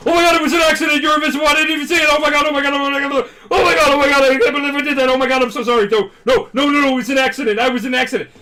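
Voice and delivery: loud, high panicked apology; hammed-up flustered panic; wigging out. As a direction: babble frantically at the top of your voice. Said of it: "wigs out".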